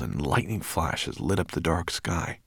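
plain reading voice

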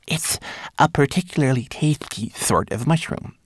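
high, lightly hushed little voice; little creature's partly voiced whisper; whispering Twigleg. A narrator's reading. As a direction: confide it in a small high voice. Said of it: "Twigleg whispered back".

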